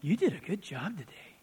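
a soft unvoiced tempter's whisper, close in the ear; sly Satan whisper. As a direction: flatter him in a hushed, sly whisper. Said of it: "Satan goes".

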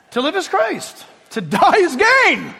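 high, slow triumphant cry; ringing proclamation. Acted. Paul proclaiming faith to his enemies, high triumphant cry. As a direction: ring it out in triumph. Preacher proclaims Paul's motto.

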